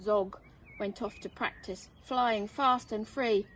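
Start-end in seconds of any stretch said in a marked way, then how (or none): none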